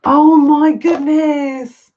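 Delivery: drawn-out, high, loud oh my goodness; awed exclamation. Real, not acted. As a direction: let the wonder stretch each word out. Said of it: "slow awed exclamation".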